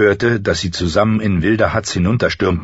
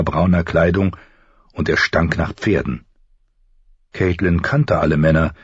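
usual speaking voice

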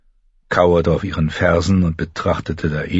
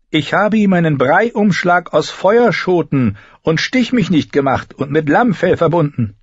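high, loud character voice; indignant healer woman; 12 semitones higher, 5 dB louder than usual. A narrator's reading. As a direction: defend your remedy forcefully.

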